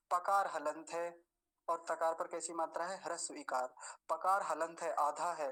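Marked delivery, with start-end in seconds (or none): none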